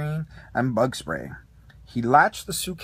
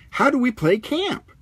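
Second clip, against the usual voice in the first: higher, louder eager question; Ham asking; 9 semitones higher, 6 dB louder than usual. A narrator's reading. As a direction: ask it up high and keen. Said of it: "asked Ham".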